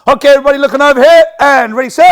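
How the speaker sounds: booming instructor call to stop; projected class call. Shown as a demonstration. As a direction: call the whole class to attention. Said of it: "if you've got the voice to do so".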